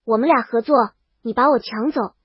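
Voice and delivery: ordinary delivery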